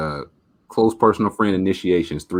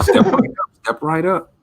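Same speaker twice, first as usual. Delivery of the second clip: carnival barker; 10 semitones higher, 5 dB louder than usual. Retold or imitated. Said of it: "I'm like".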